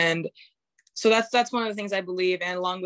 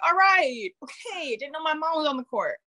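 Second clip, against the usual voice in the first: high, taken-aback quip; mock surprised reaction; 9 semitones higher than usual. Player to a rough opponent, mock surprise. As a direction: react in a raised voice, half-joking.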